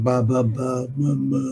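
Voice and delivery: put-on ba, ba, ba as a baby babbles; lightly imitated babbling syllables; baby babbling demo. Shown as a demonstration. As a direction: sound it out like an infant, lightly voiced. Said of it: "consonant plus vowel sound".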